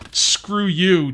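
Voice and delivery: put-on blurted tough-guy quote, no real accent; Arnold Schwarzenegger. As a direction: lightly imitate an action-film one-liner. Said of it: "think of Arnold Schwarzenegger saying".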